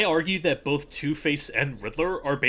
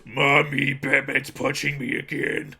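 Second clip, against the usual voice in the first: whiny creature voice; 4 dB louder, slower than usual. Pretend. Voicing a punched pod.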